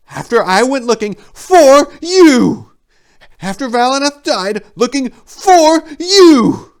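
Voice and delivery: high, loud trembling fury; barely contained rage. Acted. Silenus defending himself to Tor, loud trembling rage. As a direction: rage barely held in, spilling over. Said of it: "trembled and barely contained rage".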